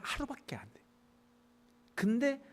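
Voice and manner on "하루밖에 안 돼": a soft whispered aside. Real, not acted. Preacher whispers aside about the short-lived gourd. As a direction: hush it like a secret.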